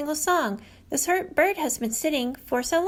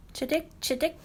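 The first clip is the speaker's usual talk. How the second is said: sparrow jingle; 2 semitones higher, 4 dB quieter than usual. Retold or imitated. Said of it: "Sparrow makes a simple jingle".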